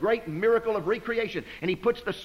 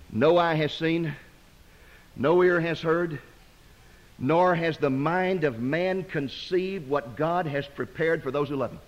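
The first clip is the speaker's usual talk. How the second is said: slow, measured Bible reading; solemn scripture reading; 4 semitones lower, slower than usual. Acted. Preacher reads Paul's words to the church, slow and solemn. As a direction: let each phrase land slowly.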